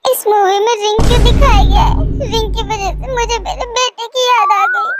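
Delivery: tearful. Acted. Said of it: "पहले रोना बंद करो".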